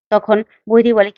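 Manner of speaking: plain reading voice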